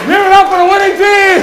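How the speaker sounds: high, loud game-show hype; calling for applause. Real, not acted. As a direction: whip up the crowd for the winners. Host calls for applause.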